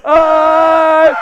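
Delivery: roaring wind-up; stretched hype yell. Real, not acted. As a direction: build the crowd up before the song. Winding up to the chant.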